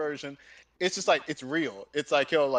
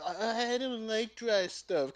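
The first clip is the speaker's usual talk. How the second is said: slow, higher-pitched mock complaint; mocking whine; 5 semitones higher, 3 dB quieter, slower than usual. Mocking people who can't stand dry shows, whiny. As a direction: imitate a babyish picky viewer.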